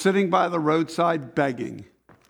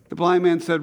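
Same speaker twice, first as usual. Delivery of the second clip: usual speaking voice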